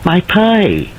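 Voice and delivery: high anguished cry. Acted. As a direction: cry out in alarm. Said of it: "An anguished cry of".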